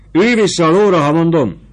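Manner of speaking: very high, loud angry command; angry snap. Acted. Sutler woman angrily orders Fabrizio back on the horse, loud. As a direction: snap it at him furiously. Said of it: "csattant fel a markotányos nő dühösen".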